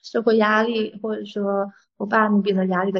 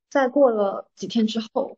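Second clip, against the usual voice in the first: usual speaking voice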